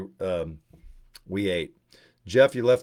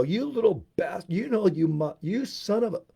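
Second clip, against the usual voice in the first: high, cut-off mock cursing at the kids; mock angry outburst; 8 semitones higher than usual. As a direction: pretend to curse them out, stopping short each time. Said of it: "I wanted to get out and go".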